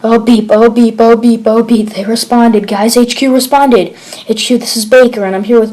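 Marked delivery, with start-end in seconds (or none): 0.0-4.0 louder, breathless relief; relieved excitement. Acted. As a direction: gasp out the good news to the team. Soldier hears reply at last.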